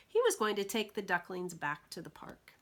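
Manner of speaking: plain reading voice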